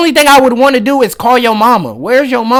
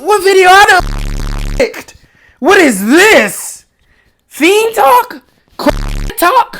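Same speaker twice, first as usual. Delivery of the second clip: higher, slow, disbelieving questions; high, slow shocked reaction to a bad video; incredulous surprise; 7 semitones higher, much slower than usual. Real, not acted. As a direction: stretch it out like you can't believe what you clicked on. Reacting to disturbing clip.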